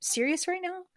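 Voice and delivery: stunned and unbelieving; disbelief. Retold or imitated. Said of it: "I just looked at him like".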